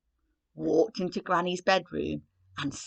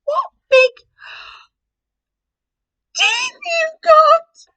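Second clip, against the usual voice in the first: very high, loud, very slow frightened cry; terrified Red; 14 dB louder, slower than usual. Acted. Red seeing the wolf's teeth, very high terrified cry. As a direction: drag it out in terror.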